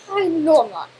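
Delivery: usual speaking voice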